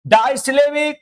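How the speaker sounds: ordinary talk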